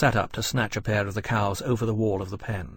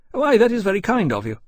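high, loud, pleased surprise; thawing courier captain; 10 semitones higher, 6 dB louder than usual. A narrator's reading. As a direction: thank him brightly in a high voice. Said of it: "thawing visibly".

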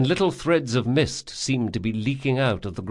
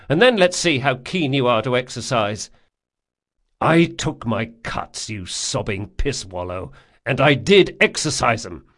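higher, louder, bitter retort; prickly wounded man; 3 semitones higher, 3 dB louder than usual. Acted. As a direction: snap back through the pain. Locke snaps back at Jean.

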